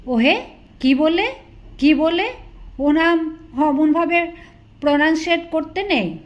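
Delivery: high, loud anguished protest; near wail. Acted. Friend wails in protest at the rude name. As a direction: cry out in horror at the word. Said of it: "প্রায় আর্তনাদ করে বলে উঠলেন".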